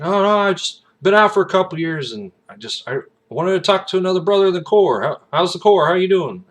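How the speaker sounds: gung-ho veteran chatting up a brother; eager old Marine. Retold or imitated. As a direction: former Marine greeting a recruit. Retold former Marine chatting eagerly.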